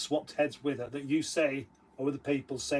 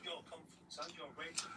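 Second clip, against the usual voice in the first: whispering; 20 dB quieter, faster than usual. Acted. Whispered repeat of own line.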